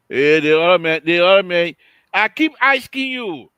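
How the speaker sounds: hey you, over there; calling out. Real, not acted. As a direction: shout across to him. Host calling out to absent caller.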